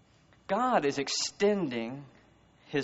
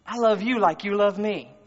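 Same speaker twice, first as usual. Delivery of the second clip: eager reply; 7 semitones higher, 7 dB louder than usual. Acted. Voicing the listener's answer.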